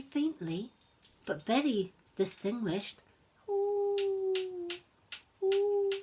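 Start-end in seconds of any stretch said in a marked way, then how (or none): 3.5-5.9 high howl from far away; distant wolf howl. Acted. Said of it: "In the distance they could hear, very faintly".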